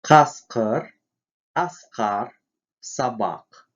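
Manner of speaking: "К" in "Каскар, аскар, собак" made far back; voiceless back-tongue stop. Shown as a demonstration. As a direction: burst the "К" from the back palate. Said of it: "заднеязычный".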